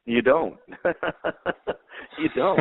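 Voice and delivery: high-pitched, amused reply breaking into real laughter; laughing. Real, not acted. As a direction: laugh it off between the words. Coach laughs off altitude question.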